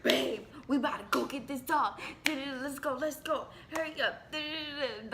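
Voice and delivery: high, loud and eager; hyped up. Retold or imitated. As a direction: mimic him rushing, all hyped. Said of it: "you were hyped".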